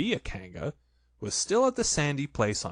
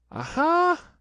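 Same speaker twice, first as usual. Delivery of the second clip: high, loud triumphant aha; squeaky aha; 6 dB louder than usual. A narrator's reading. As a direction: Piglet trying to sound bold. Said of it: "said Piglet".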